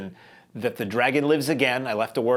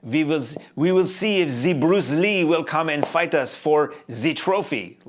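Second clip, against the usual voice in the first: slow, hammed-up fake French accent; mock the dubbed French accent, drawing it out slowly; bad French accent; 4 semitones higher, 4 dB louder, slower than usual. Reviewer mocking the film's Exorcist dub, bad French accent. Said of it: "he has this really bad, uh, ch-, uh, French accent".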